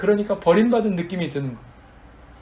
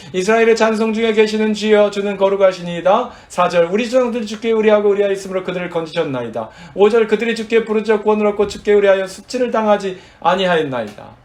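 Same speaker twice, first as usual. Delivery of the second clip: loud, raised-pitch psalm recitation; forceful scripture reading; 5 semitones higher, 6 dB louder than usual. Acted. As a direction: declaim the verses with preaching force. Reads psalm verses at full force.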